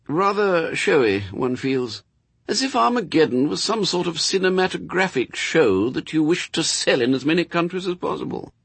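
high-pitched, refined disapproval; fastidious angel, disapproving. Acted. Angel to Crowley about Armageddon, fastidious and high. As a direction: pitch it high, a fussy angel sniffing at showiness. Angel's dialogue in narrated story.

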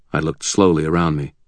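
ordinary delivery, nothing marked

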